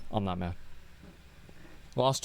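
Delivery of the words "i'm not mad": sulky denial. Real, not acted.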